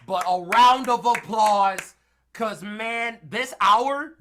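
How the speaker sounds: loud, slow call for applause; excited cheer. Real, not acted. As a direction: hype up the crowd. Calling for applause.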